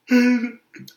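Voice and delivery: high, loud mock sobbing; hammed-up crying noise; imitated bawling. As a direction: act out a burst of wailing sobs. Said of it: "I start bawling tears".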